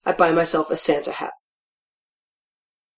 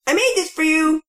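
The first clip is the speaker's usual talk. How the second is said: put-on excited kid; 9 semitones higher than usual. Child proudly calling to grandma.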